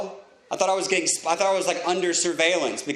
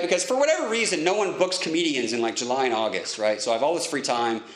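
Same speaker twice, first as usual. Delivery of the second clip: ordinary delivery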